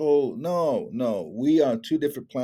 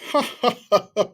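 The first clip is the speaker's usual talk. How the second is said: put-on older elder laugh; 10 semitones higher, 3 dB louder than usual. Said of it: "he does that laugh".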